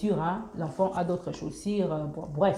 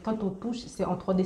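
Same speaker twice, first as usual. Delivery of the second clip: usual speaking voice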